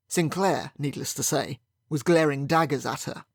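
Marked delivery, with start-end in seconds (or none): none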